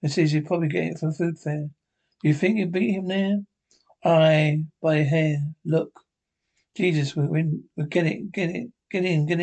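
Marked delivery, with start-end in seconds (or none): none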